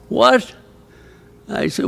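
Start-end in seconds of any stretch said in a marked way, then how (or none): 0.1-0.5 sudden high, startled What; shocked what. Retold or imitated. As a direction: blurt it out in disbelief. A crowd shouting in disbelief.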